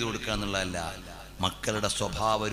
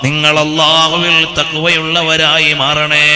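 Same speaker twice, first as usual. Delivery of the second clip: loud, high chanted sermon; preaching; 6 semitones higher, 19 dB louder than usual. Acted. Sermon chanted at full force.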